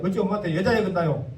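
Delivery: fast, indignant Jeolla scolding; Jeolla indignation. Retold or imitated. A listener scolding Jesus's rudeness, in indignant Jeolla dialect. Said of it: "전라도 말로 좀 싸가지가 없네".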